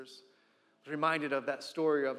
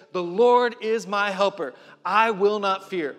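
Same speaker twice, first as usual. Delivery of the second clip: louder, slow and firm; loud slow declaration; 3 semitones higher, 8 dB louder, slower than usual. Acted. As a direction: proclaim it, every word weighted. Confident declaration from scripture.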